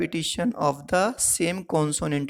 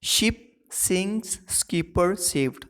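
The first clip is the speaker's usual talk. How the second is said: शिप sinks, skipper saved said slowly, higher, leaning on each s; slow example read; 3 semitones higher, slower than usual. Shown as a demonstration. Teacher reading the alliteration example to students. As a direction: spell out the s sounds slowly. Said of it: "example से अच्छे से आएगा".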